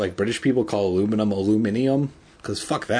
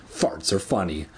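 plain reading voice

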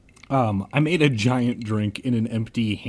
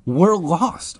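high, louder plea; crying out; 7 semitones higher, 5 dB louder than usual. A narrator's reading. Lost narrator to the gray kids, crying out. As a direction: cry out for help. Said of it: "I cried".

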